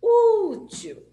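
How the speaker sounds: Ú in "útil" long, high and loud; "útil" called out with a held first vowel; stress on Ú. Shown as a demonstration. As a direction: holler "útil", stretching the first syllable. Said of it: "Quando é a penúltima que é forte".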